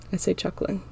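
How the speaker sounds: usual speaking voice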